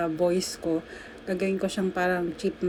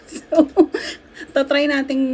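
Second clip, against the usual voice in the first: laughing; 5 semitones higher, 4 dB louder than usual. Real, not acted. Laughs about singing it.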